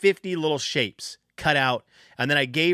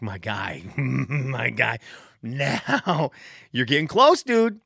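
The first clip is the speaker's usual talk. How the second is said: laughing coax; much slower than usual. Retold or imitated. Dad coaxing son into pants.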